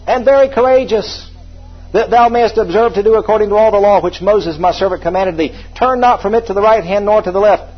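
high, loud charge from the pulpit; ringing, emphatic Bible declamation; forceful scripture preaching. Real, not acted. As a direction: proclaim the verse, opening big.

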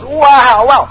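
loud, high amazement; a boy's excited wow; amazed wow. Acted. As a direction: gasp in wonder. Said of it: "Solomon goes".

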